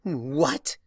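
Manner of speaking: loud surprised gasp of a question; startled what. Acted. Starlight shocked by Discord's reveal, startled. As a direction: jolt in shock.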